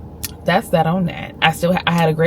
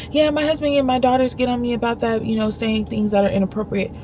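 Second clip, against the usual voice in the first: a touch higher, sheepish; lightly imitating the lady; 5 semitones higher, 4 dB quieter than usual. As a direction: play her mild excuse. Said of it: "She's like".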